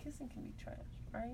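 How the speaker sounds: secret whisper meant to stay off the mic; off-air whisper. Real, not acted. Host confessing to her co-hosts off the record, whispered. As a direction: lean in and whisper it so the mic won't catch it. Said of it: "saying stuff off the air".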